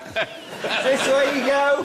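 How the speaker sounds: high, amused put-on voice of a pilot; playing at being the pilot, laughing; mock pilot voice. Joking with the panel, putting on a pilot voice.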